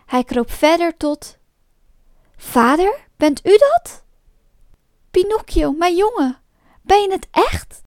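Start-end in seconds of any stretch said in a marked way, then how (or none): 2.4-4.0 loud, high, rising disbelief; astonished call. Acted. Pinocchio finds his father in the whale, astonished call. 5.1-7.8 high-pitched, amazed exclamation; overjoyed disbelief. Acted. Father recognises his lost boy Pinocchio, overjoyed disbelief.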